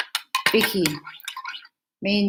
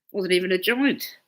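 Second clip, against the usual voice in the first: ordinary talk, nothing marked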